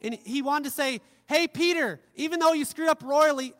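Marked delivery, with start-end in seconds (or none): 1.3-3.5 loud, raised-pitch hail to a friend; hearty calling out. Acted. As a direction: call out to him with emphatic reassurance. Jesus hailing Peter to restore him.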